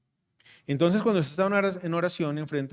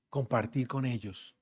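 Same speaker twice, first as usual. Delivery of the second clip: ordinary talk, nothing marked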